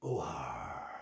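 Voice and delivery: low, soft growled pirate call; a gruff pirate grunt; pirate growl ooh-ah. Acted. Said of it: "he said".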